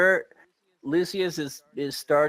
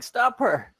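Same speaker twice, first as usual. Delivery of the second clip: urgent shout; 9 semitones higher, 4 dB louder than usual. Acted. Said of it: "Andolph is saying".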